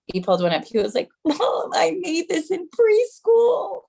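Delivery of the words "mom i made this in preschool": put-on high, slow, teary little boy; near tears kid. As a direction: plead like a kid about to cry. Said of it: "almost crying".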